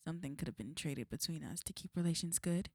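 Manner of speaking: ordinary delivery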